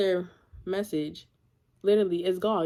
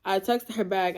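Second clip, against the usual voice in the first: ordinary talk, nothing marked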